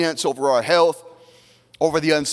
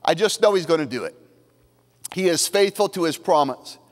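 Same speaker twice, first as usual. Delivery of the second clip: ordinary talk, nothing marked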